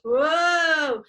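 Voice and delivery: loud, drawn-out "whoa"; mock reeling cry, long and loud; pretend dizzy whoa. Teacher recovering from pretend centrifuge spin, dizzy whoa.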